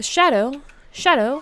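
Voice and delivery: loud call across the room. Real, not acted. Calling out to someone named Shadow.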